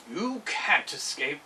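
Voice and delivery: slow, low growling bear. Acted. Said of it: "growled the bear".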